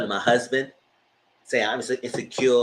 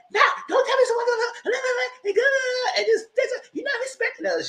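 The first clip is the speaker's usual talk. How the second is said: high imitated string of no's; mock heated refusal; faster than usual. Imitating Annie refusing to let Zari talk, high-pitched. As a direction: shut her down in a put-on high voice. Retold argument quote.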